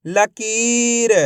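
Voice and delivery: "लकीर" very slowly as one word; slow teaching read of "लकीर"; blended, drawn-out word. Shown as a demonstration. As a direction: hold the ee in "लकीर". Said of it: "आपस में मिला के पढ़ेंगे".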